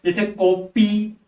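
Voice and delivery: कॉपी said slowly with a clear ई at the end; slow teacher demo of कॉपी ending in ई. Shown as a demonstration. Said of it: "ई की मात्रा लग रही है".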